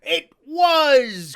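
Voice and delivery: high, loud eager burst; quite excited. Acted. Silver excited at the name, high and loud. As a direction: jump on it. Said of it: "now quite excited".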